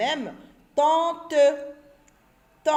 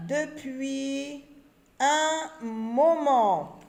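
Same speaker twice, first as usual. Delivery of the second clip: slow, with pauses between the words; 2 semitones higher, much slower than usual. Shown as a demonstration. Teacher reads the sentence word by word for the children. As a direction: read the sentence one word at a time.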